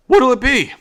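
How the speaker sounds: very high, loud cracking voice; a scared bartender's voice cracks; squeaky, cracking barman's question. Acted. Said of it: "Bartender's voice crack".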